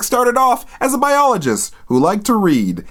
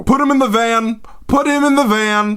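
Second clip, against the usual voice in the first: higher-pitched barked gang command; mock shouted order; 4 semitones higher than usual. Marauder orders Nathaniel taken away, mock shouted order. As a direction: order the gang about, hammed-up.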